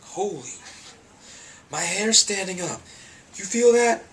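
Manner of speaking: imitating Kev's goosebump excitement; excited. Kev hearing the sermon over the beat, thrilled high yelp. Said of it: "he was totally excited".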